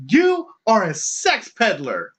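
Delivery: high, slow mock condemnation; accusing protester. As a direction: pronounce judgement in a lofty voice. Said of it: "she, the vocal protester".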